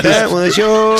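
mock falsetto. Repeats bit in falsetto.

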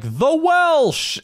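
high, drawn-out hail of a friend; excited phone greeting. Retold or imitated. Director hailing the host on the phone, mock excited greeting. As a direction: greet a buddy by nickname with a big high swoop.

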